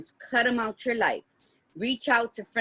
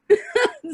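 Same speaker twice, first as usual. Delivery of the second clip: high laugh; 14 semitones higher, 4 dB louder than usual. Real, not acted. Laughs at being hopeless romantic.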